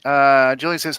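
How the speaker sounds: ordinary delivery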